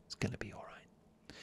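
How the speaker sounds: hushed whisper of reassurance. Shown as a demonstration. Host whispering comfort to listeners in bed, whispered. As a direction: whisper it close and gentle. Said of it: "do you like the whisper?".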